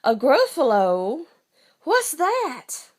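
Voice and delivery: high, loud startled question; astonished fox. Acted. Fox startled by the gruffalo, high and loud. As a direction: gasp it out like the fox never heard of it.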